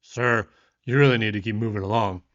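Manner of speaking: lightly voiced gruff cop; a hint of an officious grunt. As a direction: brush someone off like an officer. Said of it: "cut me off right there and grunted".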